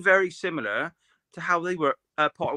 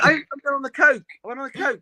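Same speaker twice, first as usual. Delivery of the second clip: high-pitched, hammed-up impression with an accent; Josh impersonation; 9 semitones higher, faster than usual. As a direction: put on a jokey, much higher mimicking voice. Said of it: "do your best Josh impersonation".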